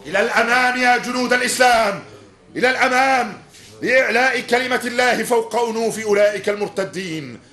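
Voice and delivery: rally the troops forward; battle cry. Acted. Commander urging troops into battle.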